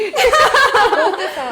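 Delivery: loud, high burst of laughter. Real, not acted. Laughing at teasing the other.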